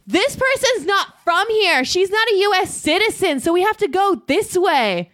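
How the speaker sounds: imitating a boss calling out across an airport; retold boss yell. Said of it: "my boss decided to yell in the airport".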